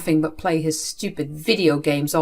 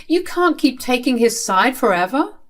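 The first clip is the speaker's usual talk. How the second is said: ordinary talk, nothing marked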